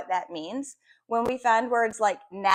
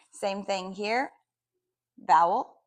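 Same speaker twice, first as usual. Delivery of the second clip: usual speaking voice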